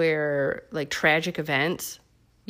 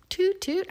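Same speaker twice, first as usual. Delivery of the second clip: train horn toot; 14 semitones higher than usual. Pretend. Driving the struggle bus.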